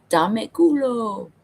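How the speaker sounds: a casual, drawn-out repeat of the phrase. Retold or imitated. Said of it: "he's like".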